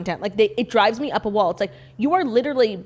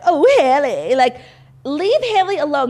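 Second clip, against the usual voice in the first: mock dismay; 6 semitones higher, 6 dB louder than usual. Mock exclamation over Hailey's treatment.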